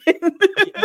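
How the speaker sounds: sudden high laugh; high burst of laughter. Real, not acted. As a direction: crack up at the joke. Laughs at [name] joke.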